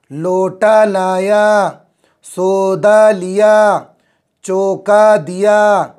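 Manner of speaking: each word stretched out slowly for the class; slow, deliberate classroom read-aloud; slow reading drill. Shown as a demonstration. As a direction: read it syllable by syllable like a teacher. Teacher drills word phrases slowly.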